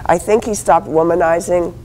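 ordinary talk

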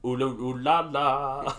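slow mock French ooh la la; sing-song French flourish to close; mock French sign-off. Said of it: "as I say at the end".

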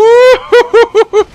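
high, shouted burst of laughter; loud whooping laugh. Real, not acted. Player bursting out at the game's chaos, whooping laugh.

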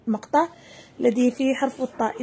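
ordinary delivery

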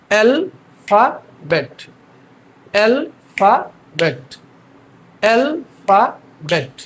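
"Alphabet" very slow, with gaps between al, fa, bet; syllable by syllable. Shown as a demonstration. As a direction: drill "alphabet" in three spaced beats. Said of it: "তাহলে আপনারা দেখেন". ("Alphabet" তিন ভাগে ভেঙে ধীরে ধীরে)

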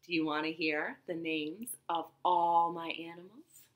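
plain reading voice

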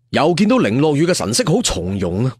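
ordinary delivery, nothing marked

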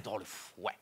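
soft whispered punchline. Acted. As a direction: whisper it conspiratorially.

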